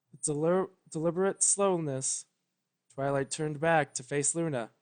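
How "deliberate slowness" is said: usual speaking voice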